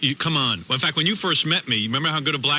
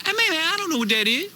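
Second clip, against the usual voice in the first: much higher put-on street voice, playing dumb; mock clueless homeboy; hammed-up dumb slang. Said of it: "you gotta talk like that".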